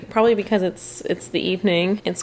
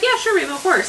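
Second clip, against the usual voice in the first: higher, breezy mock agreement; imitating boyfriend; 10 semitones higher than usual. Wife imitating Mario agreeing, light and high. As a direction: mimic him saying sure, babe. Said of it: "So he's like".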